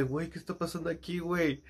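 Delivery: high, hushed astonishment; hushed, baffled reaction; stunned disbelief. Retold or imitated. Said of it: "yo así estaba impactado de".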